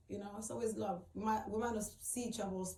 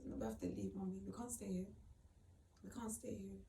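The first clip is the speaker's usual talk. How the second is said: subdued refusal; 9 dB quieter than usual. Retold or imitated. Mother tells her son they must leave, subdued refusal. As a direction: break it to a child quietly.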